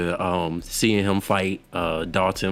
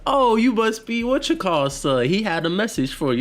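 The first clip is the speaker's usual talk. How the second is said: much higher, playful imitation of a movie line; high mock character voice; 12 semitones higher than usual. Fan quoting a favorite scene to his friend, high mock voice. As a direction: retell it in a raised, put-on voice.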